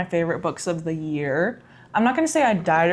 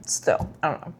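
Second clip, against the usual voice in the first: whisper; 3 semitones lower, 4 dB quieter than usual. Real, not acted. Unsure about the book's ending.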